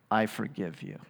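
quiet, hushed "I forgive you" with the stress on "I"; soft pretend pardon. Preacher pretending to forgive Tom, softly. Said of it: "I'm like".

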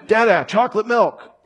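high-pitched calling for Dada, mock yell; yelling toddler. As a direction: imitate a little boy calling out insistently. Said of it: "he was yelling to me".

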